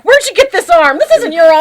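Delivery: high, loud shout of outrage; mock outraged shout. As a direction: yell it like an angry pimp. Acting out Zorro's outburst.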